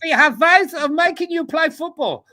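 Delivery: loud, high, mock-menacing interrogator; hammed-up threat, pitched far above normal; Hansi Flick. Co-host plays the coach threatening players, loud high impression. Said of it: "a, a Hansi Flick, uh, thing".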